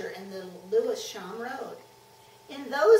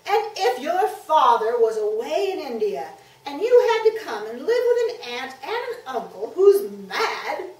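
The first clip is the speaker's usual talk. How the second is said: put-on loud, miserable child's tirade; upset boy, raised voice; wound-up outburst; 4 semitones higher, 12 dB louder than usual. A narrator's reading. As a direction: rant on without heeding her. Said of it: "the boy was too wound up".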